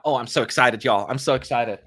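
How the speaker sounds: normal talking voice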